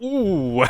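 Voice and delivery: high, loud crowd-style "ooh" at a burn; reactive "ooh". Retold or imitated. Viewer reacting to Owen's burn, re-enacted loud "ooh". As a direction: let out a rising, impressed "ooh".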